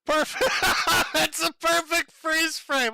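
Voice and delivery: high, loud words through laughter; laughing hard. Real, not acted. Host seeing a perfect freeze frame, laughing. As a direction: crack up while you talk. Cracks up at paused clip.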